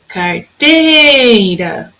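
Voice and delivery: "Carteira" with a long held "tei"; slow "Carteira", swelling on "tei"; stretched middle syllable. Shown as a demonstration. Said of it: "Minha sílaba tônica na palavra carteira é o teira".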